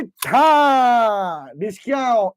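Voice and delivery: long drawn-out bang noise; vocal gun sound, stretched out and falling; imitated gunshot. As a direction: play-act a pistol firing. Imitates gunshots in his story.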